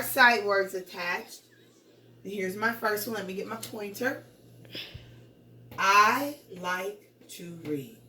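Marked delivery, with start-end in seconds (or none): 5.8-8.0 slow, word by word, falling at the end; statement read. Shown as a demonstration. Teacher reading a first sentence to pupils, slow statement read. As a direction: read it slowly and let READ fall. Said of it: "That's how I'll read that".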